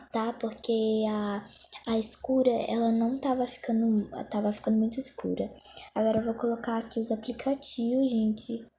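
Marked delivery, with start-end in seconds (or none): none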